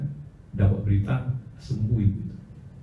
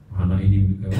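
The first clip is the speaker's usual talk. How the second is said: demon voice; 4 semitones lower, 8 dB louder, faster than usual. Retold or imitated. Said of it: "Ngomongnya ngomong cowok".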